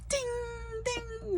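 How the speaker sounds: a high, ringing "ding, ding" like a bell. Shown as a demonstration. Her light-bulb moment as a high chiming ding. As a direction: chime it high like a bell going off. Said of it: "the bell that goes with the light bulb goes ding".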